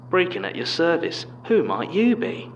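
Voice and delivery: high, loud cartoonish Cockney accent; broad Cockney mockery. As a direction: parrot him in cartoon Cockney. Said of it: "he spoke in a broad, cartoonish Cockney that I assumed must have been a mocking impression".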